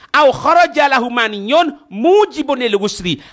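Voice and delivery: ordinary talk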